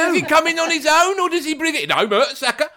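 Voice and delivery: high, gleeful gossip voice; lively gleeful back-and-forth; high gleeful banter; amused retelling. Retold or imitated. High laughing retold dialogue.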